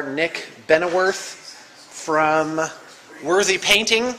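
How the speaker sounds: normal talking voice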